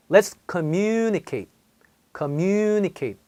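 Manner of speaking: communicate with the mu syllable held long; stretched communicate. Shown as a demonstration. Teacher corrects a common mistake for her students. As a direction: drag out the stress in communicate. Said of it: "제가 이걸 찝어줬는데".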